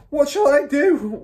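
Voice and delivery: high, loud, drawn-out wail; father's wail. A narrator's reading. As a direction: wail helplessly. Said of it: "wailed the father".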